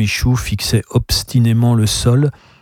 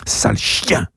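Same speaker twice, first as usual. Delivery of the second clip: juron soufflé, plus bas; grommelé; 3 dB quieter, slower than usual. A narrator's reading. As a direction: grogner l'insulte entre ses dents. (le pharmacien grommelle contre le chien)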